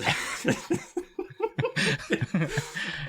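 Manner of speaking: high, breathy burst of laughter. Real, not acted. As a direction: break into a giggle. Embarrassed at long email.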